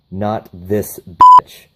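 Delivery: mock tough-guy retort; slow defiant comeback. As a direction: lay it down slowly, daring him. John Wick's cold comeback.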